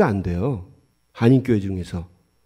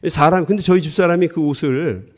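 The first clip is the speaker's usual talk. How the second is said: normal talking voice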